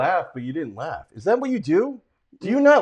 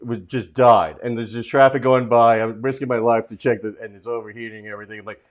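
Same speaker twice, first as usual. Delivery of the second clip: usual speaking voice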